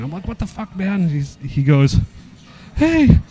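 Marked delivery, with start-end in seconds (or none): 0.3-1.0 higher but soft mock outrage; retold raging. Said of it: "I'm raging". 2.8-3.2 loud, very high mock protest; whiny little wimp. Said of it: "being such a little bitch".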